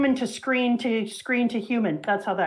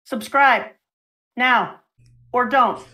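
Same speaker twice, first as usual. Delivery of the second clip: loud, punchy sales pitch, word by word; blunt, louder mock plug; second YouTube plug; 4 dB louder, slower than usual. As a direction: punch out each piece with pauses between. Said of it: "then I do my second YouTube plug".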